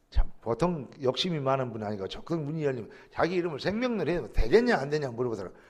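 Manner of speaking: ordinary talk, nothing marked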